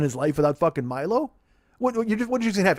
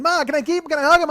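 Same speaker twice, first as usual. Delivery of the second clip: put-on much higher, pleading kid voice; begging little kid; 12 semitones higher, 6 dB louder, faster than usual. Pretending to be a kid begging his mom for a dog, high pleading. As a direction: beg Ma like a child desperate for the puppy. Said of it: "I just wanna fucking".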